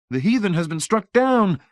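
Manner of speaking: high, loud pious verdict; smug technician. Acted. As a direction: pronounce the heathen's defeat.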